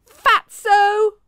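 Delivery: high, loud taunting shout. A narrator's reading. Poppy shouting an insult after Emma, loud and high. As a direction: yell the insult after her. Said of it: "Poppy shouted after her".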